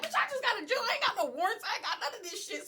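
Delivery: high-pitched, indignant protest; indignant retort. Retold or imitated. Retelling her retort when police ask for her, indignant. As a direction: protest it like you've done nothing.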